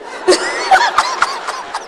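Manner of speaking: high, delighted laugh; squealing laugh; high burst of laughter. Real, not acted. Laughs at grandchild's remark.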